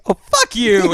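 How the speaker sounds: high, loud, laughing fuck you; laughing retort. Retold or imitated. Roommate laughing at news of a dead pet, loud and high. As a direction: burst out laughing mid-curse. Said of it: "And he goes".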